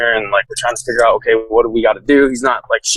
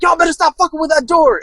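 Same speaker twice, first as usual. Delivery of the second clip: much higher, hammed-up angry yell from behind a door; angry threat; 14 semitones higher than usual. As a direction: bark it like a threat through the door. Suspect pounds on door, threatens.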